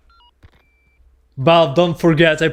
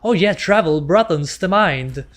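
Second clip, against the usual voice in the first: lightly higher, airy game lady voice; lady's voice; 5 semitones higher than usual. Retold or imitated. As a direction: mimic a chirpy game narrator. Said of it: "lady thing stop saying".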